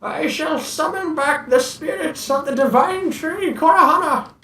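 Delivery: put-on high, slow elderly villager voice; old man voice. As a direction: play a frail old sage, solemn.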